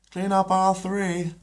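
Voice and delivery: high, slow mock tannoy call; store announcement. Peter imitating the store announcer, high and slow. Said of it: "imitates the store announcement".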